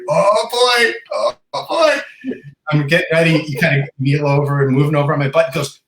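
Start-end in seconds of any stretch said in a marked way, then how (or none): 0.1-2.3 high, drawn-out mock dismay; mock alarm. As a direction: imitate dread watching a friend drop. Imitates reaction to friend falling away.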